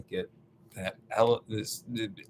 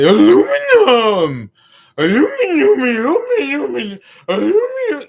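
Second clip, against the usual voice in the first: very high, loud, overexcited chant; caveman talk; 12 dB louder than usual. Acted. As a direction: shriek "Aluminum" like an exultant caveman. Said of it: "talk about caveman talk".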